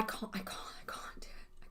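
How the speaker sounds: breathless disgusted whisper. Real, not acted. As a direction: whisper it, overwhelmed. Disgust at caramel smell.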